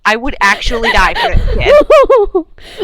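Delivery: overjoyed laughing vow; laughing emphasis. Acted. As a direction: laugh it out with feeling. Repeated with more force.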